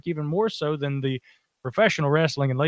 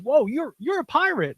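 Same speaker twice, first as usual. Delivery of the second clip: much higher, wide-eyed wonder; amazed fella; 11 semitones higher than usual. Retold or imitated. Fella on the pier, higher in amazement. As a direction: gasp at meeting a real pirate. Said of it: "he goes".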